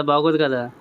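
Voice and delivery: ordinary delivery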